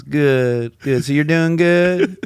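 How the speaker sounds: high, drawn-out Southern small talk; Southern drawl. Retold or imitated. Richard chatting to a stranger on the phone, Southern drawl. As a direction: keep it going in a Southern drawl. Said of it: "He's from the South".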